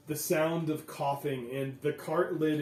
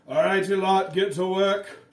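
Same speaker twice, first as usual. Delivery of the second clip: a bit higher and louder gruff call; foreman; 5 semitones higher, 7 dB louder than usual. Acted. Foreman tells the crew to unload, raised voice. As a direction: order the workers around. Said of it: "someone says".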